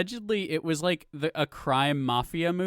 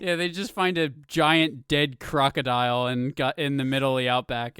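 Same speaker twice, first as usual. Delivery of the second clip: normal talking voice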